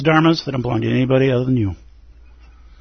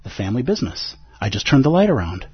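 ordinary talk

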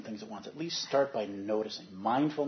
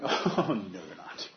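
a laughing "oh no, they're not"; laughing realization; 5 semitones lower, slower than usual. Retold or imitated. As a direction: catch yourself and laugh. Laughing at her own realization.

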